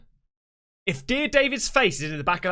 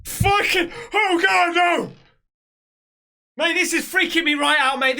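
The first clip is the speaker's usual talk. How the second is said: panicked cries; 8 semitones higher than usual. Real, not acted. Begging the video's heartbeat to stop, high panicked cries. Terrified by heartbeat sound effect.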